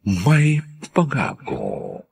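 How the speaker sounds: normal talking voice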